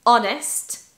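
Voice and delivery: Honest said with no H, starting on the vowel; silent H. Shown as a demonstration. Teacher showing silent H, Honest without H. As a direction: drop the H of Honest entirely. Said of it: "on va pas dire le H".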